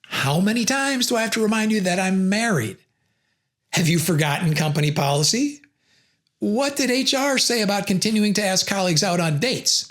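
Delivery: mildly exasperated, pointed questions; a touch of weary reproach; rhetorical questions. Shown as a demonstration. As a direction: remind him who he's dealing with. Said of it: "use rhetorical questions".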